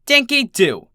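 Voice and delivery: high, loud teacher's bark. A narrator's reading. Teacher calling Dinky out from the head of the line, barking. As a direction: snap her name like a stern teacher. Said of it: "she barked".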